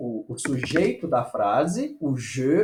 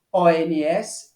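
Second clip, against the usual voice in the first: usual speaking voice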